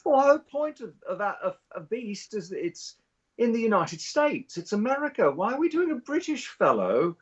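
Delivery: high British woman making an exasperated point; J K Rowling. Retold or imitated. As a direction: protest in a high-pitched British voice. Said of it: "You know, she's like".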